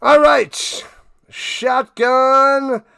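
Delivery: loud, high burst of all right; excited. Real, not acted. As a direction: fire up for the next snap. Big play starts.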